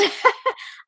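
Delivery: high, voiceless burst of laughter; amused laugh. Real, not acted. Host got a name the wrong way round, amused laugh. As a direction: laugh at your own mistake.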